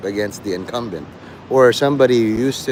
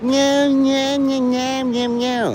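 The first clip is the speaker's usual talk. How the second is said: high, sustained cat-like whine; string out high meows on one held pitch; imitated meowing; 11 semitones higher, much slower than usual. Showing off Dirt's ability, high mock meowing. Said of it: "he showed off his".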